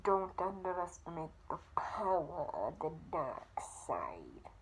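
low, whispery British-tinged villain; hushed menace, sinking very low at the end; Darth Vader. Acted. Vlogger attempts Vader impression, low whispered villain voice. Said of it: "Now it's time for Darth Vader".

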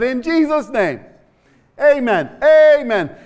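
high, loud amen that builds. Retold or imitated.

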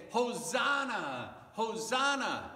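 loud, high, stretched-out cheer; cheering crowd. Acted. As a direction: yell it like a crowd at a parade. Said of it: "they were all cheering and yelling".

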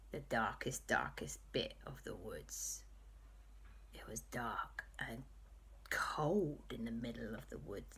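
low, soft, spooky whisper; spooky hush. A narrator's reading. Narrator hushes to set the spooky scene, whispering low. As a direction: draw the listeners into the dark woods. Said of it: "spooky storytelling".

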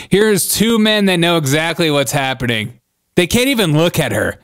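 ordinary talk, nothing marked

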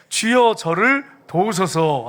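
loud, very slow, measured verse reading; stately, drawn-out recitation; scripture reading aloud. Real, not acted. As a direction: read it out deliberately for the congregation to join. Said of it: "같이 읽겠습니다".

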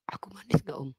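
soft whispered flirt; quiet coy whisper. Pretend. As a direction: whisper the question coyly.

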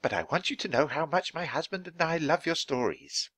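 shy admirer, high and soft-spoken; bashful high-voiced praise. A narrator's reading. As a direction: pitch it high, like a timid fan. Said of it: "the woman said shyly".

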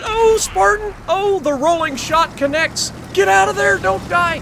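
high, loud urgent cheering at the screen; excited game reaction. Real, not acted. Gamer cheering on a teammate in a firefight, loud and high. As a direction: yell at the teammate to survive.

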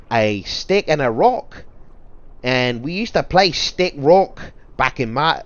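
high, loud hammed-up Australian accent; drawn-out mock-Australian storytelling, pitched high; attempted Australian accent. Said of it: "I can't do an Australian accent".

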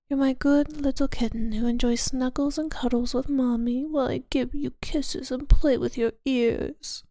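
normal talking voice

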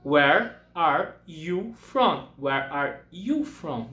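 very slow, clear English question; teacher modelling a question slowly; slow model question. Shown as a demonstration. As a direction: spell it out slowly for the class. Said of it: "A pergunta correta seria".

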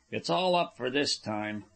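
usual speaking voice

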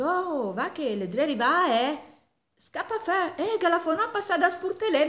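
high, fast urgent fox warning. Acted. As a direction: hurry him out before the farmer comes. Fox urging wolf to flee.

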